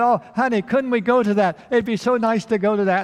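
high, pleading plea to go to the show; eager girlfriend begging her fiancé to go. Acted. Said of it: "and she begged".

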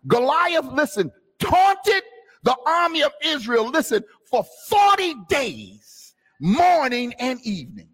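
high, loud, slow preaching of the story; fiery preaching. Acted. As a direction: proclaim it to the congregation. Preacher retells Goliath's taunting.

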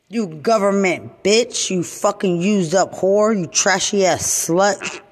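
ordinary delivery

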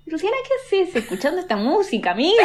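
mock self-scolding. Woman retelling how she tells herself off over music, mock scolding. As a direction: scold yourself in mock disbelief. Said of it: "Y me reto a mí misma".

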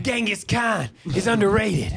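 put-on backed-off accent, slow and high; half-hearted Kanye voice; Italian-ish Kanye. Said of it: "an Italian [name]".

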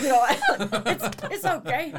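high, laughing mock Aussie reassurance; terrible Australian accent. Still voicing the Australian Siri, laughing fake Australian accent. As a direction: keep up the bad Australian voice, giggling. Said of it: "that was a terrible Australian accent there".

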